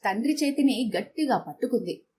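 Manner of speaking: ordinary delivery, nothing marked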